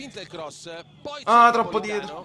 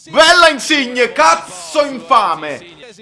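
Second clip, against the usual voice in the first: shouting; 6 semitones higher, 3 dB louder than usual. Real, not acted. Goal chance, yelling at player.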